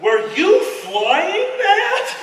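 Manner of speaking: put-on high, slow awed kid voice; a young kid amazed at the plane; wide-eyed high kid. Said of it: "walks up to me and is like".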